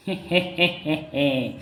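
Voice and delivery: drawn-out villainous "heh-heh-heh"; gloating chuckle. Acted. Schemer pleased at scolding the fat king, gloating chuckle. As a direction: chuckle to yourself at the victim.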